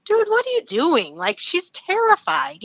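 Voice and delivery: high-pitched, exasperated reproach; indignant protest. Retold or imitated. Acts out indignant thought at guide.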